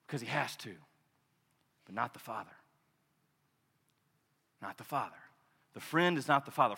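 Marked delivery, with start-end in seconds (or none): 1.9-5.2 hushed emphasis. Acted. Preacher drops to hush.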